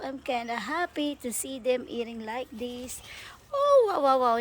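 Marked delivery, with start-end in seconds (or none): 3.5-4.4 high, loud wows; amazed wow. Real, not acted. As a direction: gasp out the wows in delight. Amazed at birds feeding.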